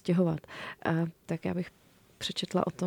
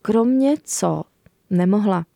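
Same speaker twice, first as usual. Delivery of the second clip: put-on reading a children's book aloud; story narration; 5 dB louder than usual. A narrator's reading. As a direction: tell it warmly to young listeners. Author reads her book aloud.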